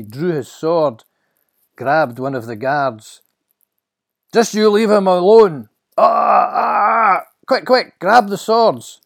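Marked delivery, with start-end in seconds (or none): 4.3-7.3 high, full-force fighting shout; attacking yell. Acted. Peter attacking the guard to free his master, yelling. 7.5-9.0 high, loud alarmed order; urgent shout. Acted. Guard to his men during the attack, urgent shout.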